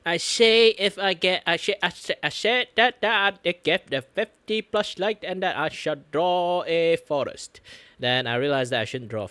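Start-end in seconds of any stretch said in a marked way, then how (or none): 0.1-7.6 loud declaration. Acted. Playful mock declaration.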